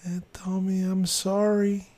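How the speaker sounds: man doing a woman's voice, high and slow; soft old lady voice. Retold or imitated. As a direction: play a sweet apologetic little old lady. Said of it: "A soft little old lady's voice".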